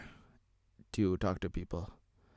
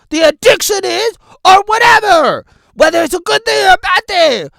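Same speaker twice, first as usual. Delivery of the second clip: loud, higher preacher build; fired-up sermon voice; preaching; 16 dB louder than usual. Acted. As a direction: preach it to the crowd. Preaching at full force.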